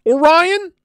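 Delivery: high, loud incredulous question; exaggerated questioning. Streamer puzzles over how the name is said, exaggerated disbelief.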